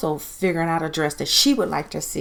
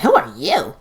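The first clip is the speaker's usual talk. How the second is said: curt, louder put-down; nasty; 5 dB louder than usual. Retold or imitated. Donna rudely brushes off the friendly friend, louder and curt. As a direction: snap at the stranger rudely.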